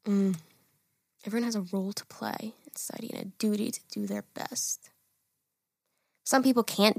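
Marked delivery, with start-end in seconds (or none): 1.2-4.9 whispered reread to herself; whispering. Shown as a demonstration. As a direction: whisper it while thinking. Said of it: "rereads the statement to herself".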